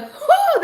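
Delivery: a loud, high hammed-up whoo; mock whoo. Streamer mimics boss whooping with fake laughter. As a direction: whoop like he's cracking up. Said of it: "he'll be like".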